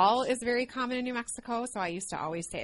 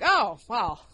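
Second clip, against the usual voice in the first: louder, teasing husband on the phone; a husband's mock-knowing tease, louder; 3 semitones higher, 11 dB louder than usual. Husband on the phone teasing her about her accent, louder mock tease. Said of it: "he was like".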